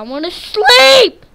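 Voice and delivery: high, loud frustrated yell; loud desperate whine; frustrated shout. Real, not acted. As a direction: yell it out in despair. Can't sleep, monsters around.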